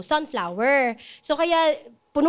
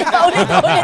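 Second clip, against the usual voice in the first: laughing; 7 dB louder than usual. Real, not acted. Laughing while speaking.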